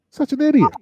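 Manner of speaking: high, loud scornful squeal of such an idiot; mocking exclamation. Real, not acted. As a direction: shriek it in disbelief and scorn. Mocking Jussie on the stand.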